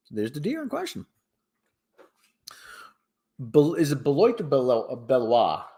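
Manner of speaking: usual speaking voice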